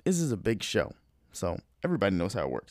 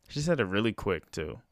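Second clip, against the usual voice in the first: usual speaking voice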